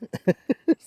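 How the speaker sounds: giddy high laugh. Real, not acted. Laughing over the find.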